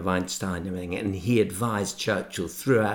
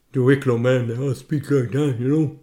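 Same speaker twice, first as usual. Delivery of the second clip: thick rural Wicklow Irish accent, louder and higher; broad Wicklow accent; 4 semitones higher, 6 dB louder than usual. Shown as a demonstration. Author shows the radio host how broad Wicklow talk sounds. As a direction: lay on the Wicklow brogue. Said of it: "if I spoke in Wicklow, local Wicklow".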